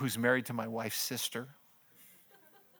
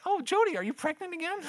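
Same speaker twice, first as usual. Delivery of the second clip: much higher-pitched mock daughter voice; high-pitched retold question; imitated teenage daughter. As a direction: squeal it high, imitating an excitable teen. Imitating daughter's startled question to family.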